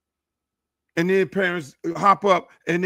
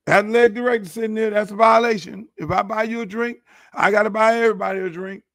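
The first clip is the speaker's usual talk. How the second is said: usual speaking voice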